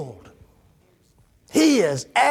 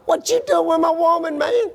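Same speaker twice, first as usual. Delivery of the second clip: hammed-up street-talk challenge, higher; mock jealous confrontation; 10 semitones higher than usual. Ishbosheth to Saul over the concubine, mock indignant. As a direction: toss it at him like a jealous rival.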